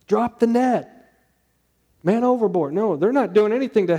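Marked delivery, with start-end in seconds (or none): 0.0-2.7 higher, urgent sailor's call; mock sailors shouting. Preacher imitating sailors calling man overboard, higher and urgent. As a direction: call it out like a sailor raising the alarm. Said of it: "Do the sailors hear him?".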